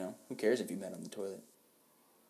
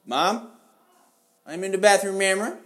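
loud, high call to mom; 12 semitones higher, 14 dB louder, slower than usual. Real, not acted. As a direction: yell through the bathroom door. Calling out to his mom.

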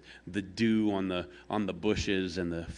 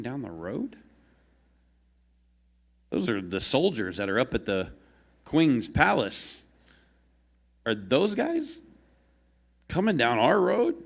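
curious surprise; 5 semitones higher than usual. Acted. Man wonders about approaching guards.

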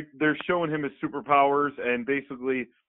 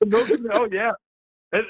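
ordinary delivery

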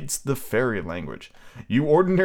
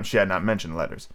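plain reading voice

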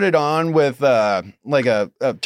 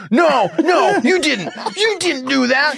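high, mock panicked protest; imitating freaked out guy; 9 semitones higher than usual. Imitating the crackhead freaking out over mushrooms, high mock panic. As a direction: ham up his panic.

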